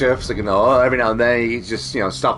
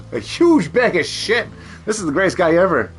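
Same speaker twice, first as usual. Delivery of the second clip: high-pitched mock rave; mock-delighted customer; 7 semitones higher than usual. Mock customer praising the yard guy, hammed-up delight. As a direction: gush over it in a raised, amused voice.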